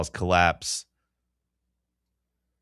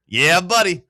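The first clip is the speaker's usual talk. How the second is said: loud, high mock "yeah buddy"; a loud bro cheer; 8 semitones higher, 6 dB louder, slower than usual. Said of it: "That's what I told him".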